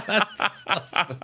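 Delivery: slow words broken by laughter; helpless laughing. Real, not acted. Co-host appalled by friend's dirty joke, laughing helplessly. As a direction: gasp it out between laughs.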